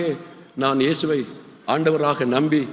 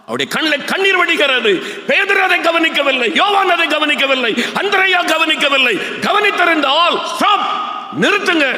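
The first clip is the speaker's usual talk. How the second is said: higher, fervent preaching cry; preaching at full force; 10 semitones higher than usual. Real, not acted. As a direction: urge the crowd to see his tears. (உணர்ச்சிமிக்க உயர்ந்த குரல் பிரசங்கம்)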